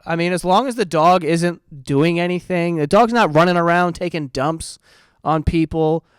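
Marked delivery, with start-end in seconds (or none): none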